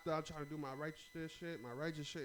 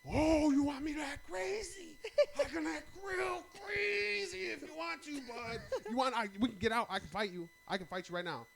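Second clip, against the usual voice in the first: mock crazy challenge; 13 semitones higher, 9 dB louder than usual. Daring the man in the car to fight, loud and high. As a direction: goad him into a fight, unhinged. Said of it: "And I'm like".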